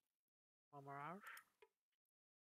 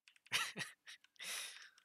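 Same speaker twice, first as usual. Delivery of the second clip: burst of laughter. Real, not acted. Laughs at the game.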